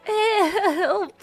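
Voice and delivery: usual speaking voice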